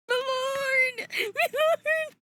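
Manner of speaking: high, drawn-out cry; tearful wail. Real, not acted. Streamer overcome by the pages, tearful wail. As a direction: wail it, overwhelmed. Overwhelmed by artwork, crying out.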